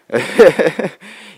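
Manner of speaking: short hearty laugh. Real, not acted. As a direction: burst out laughing. Laughs about being intoxicated.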